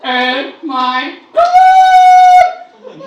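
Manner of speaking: very high, loud, drawn-out squeal; squealing woman. Retold or imitated. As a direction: shriek with delight at a stranger.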